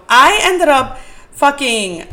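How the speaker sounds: loud and slow, drawn-out burst; loud outburst. Real, not acted. Telling podcast listeners the story, a loud slow outburst. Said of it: "sorry, that was, like, really loud".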